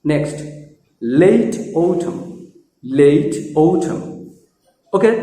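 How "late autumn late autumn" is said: slow late autumn with gliding ei; correct pronunciation. Shown as a demonstration. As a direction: stretch late, puff the t of autumn. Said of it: "সঠিক উচ্চারণগুলো দেখো".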